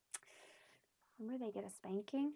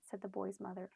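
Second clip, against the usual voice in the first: plain reading voice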